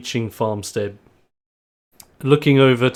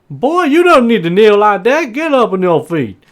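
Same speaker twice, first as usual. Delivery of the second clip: high, loud, hearty farmer; Bob; 11 semitones higher, 6 dB louder than usual. Acted. Farmer telling a kneeling wrestler to get up, high and loud. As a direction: wave off the begging, high and loud. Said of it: "at Erg and just being like".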